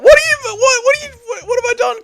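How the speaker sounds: very high, cracking mock cry of betrayal; hammed-up falsetto anguish at a viewer; mock wounded wail. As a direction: wail it like you've been stabbed in the back, for laughs. Mock hurt at chat boycott.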